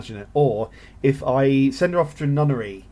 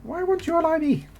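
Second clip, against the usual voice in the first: much higher whiny plea to the AI. Pretend. Gamer begging a rival nation to ally him, whiny and high. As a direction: whine like a snubbed suitor. Pleading with AI for alliance.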